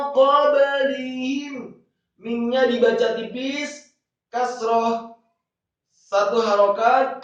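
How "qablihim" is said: slow chanted word with stretched syllables; higher, drawn-out tajwid model; Quran recitation. Shown as a demonstration. Teacher models the word for learners, Quran recitation.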